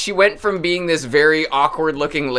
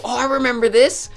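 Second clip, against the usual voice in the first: nostalgic delight; 11 semitones higher, 4 dB louder than usual. Retold or imitated. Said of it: "and go".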